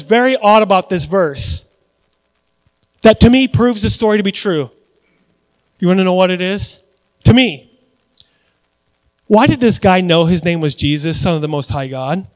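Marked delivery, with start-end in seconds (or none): none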